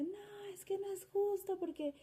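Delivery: put-on high, tearful whine of a little boy; a hint of a sobbing boy complaining; imitating crying child. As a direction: mimic a child crying it's not fair. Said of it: "entonces empieza a llorar y dice".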